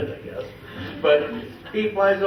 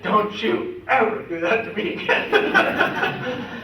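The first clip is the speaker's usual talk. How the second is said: mock scolding; 6 semitones higher, 4 dB louder, much slower than usual. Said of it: "and I said".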